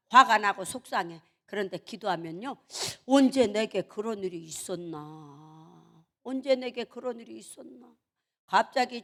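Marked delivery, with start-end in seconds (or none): 3.1-6.0 low, slow, drawn-out pondering; slow, deep-pitched wondering; slow musing. Acted. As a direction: stretch it out low as if in solemn reflection. Acted calm musing after prayer.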